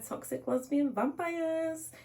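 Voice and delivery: high, slow, dramatic delivery; mock dramatic. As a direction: say it like a spooky tagline. Repeating the book's marketing hook.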